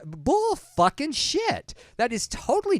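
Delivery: drawn-out outraged swear. Real, not acted. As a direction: spit it out in disbelief. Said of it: "That was [name] coming into the scene".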